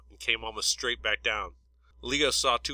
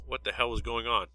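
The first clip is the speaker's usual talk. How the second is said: usual speaking voice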